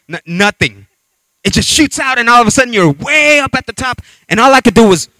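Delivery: usual speaking voice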